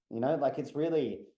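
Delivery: usual speaking voice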